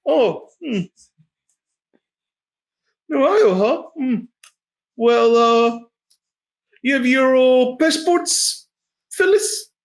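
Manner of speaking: high-pitched, hesitant shakedown voice; shady guard. Acted. Guard stopping travelers at the gate, high put-on voice.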